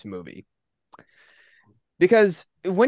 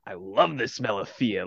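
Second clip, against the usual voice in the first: low, soft wolf menace; lightly voiced menacing wolf; intimidating villain; 6 semitones lower, 6 dB quieter than usual. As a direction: quietly savour the threat. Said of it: "the wolf, intimidating motherfucker he is".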